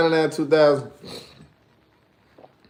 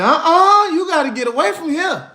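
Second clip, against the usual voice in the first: high, loud alarmed woman voice; scared mothers; 13 semitones higher, 4 dB louder than usual. Retold or imitated. Women at the party shooing him away, high alarmed voice. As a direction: shoo him off like a frightened mother. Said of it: "them folk grabbed their children and said".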